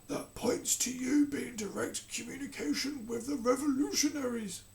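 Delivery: cold, detached monarch; King voice. Acted. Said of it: "continued the King".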